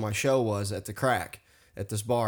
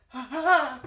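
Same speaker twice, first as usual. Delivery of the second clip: high pained gasping; pained panting; 13 semitones higher than usual. Retold or imitated. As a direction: gasp in pain. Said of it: "I would go like".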